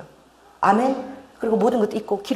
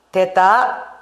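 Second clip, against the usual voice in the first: Gyeongsang dialect; 5 dB louder, much slower than usual. Shown as a demonstration. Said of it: "우리 경상도 말로 됐다 마 이러면 끝인 거야".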